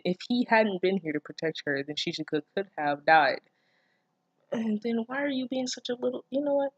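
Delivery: ordinary talk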